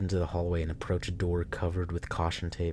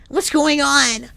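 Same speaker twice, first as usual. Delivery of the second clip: very high, loud hammed-up wail; theatrical lament; 11 dB louder, much slower than usual. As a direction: overplay a grief-stricken preacher. Same dramatic voice.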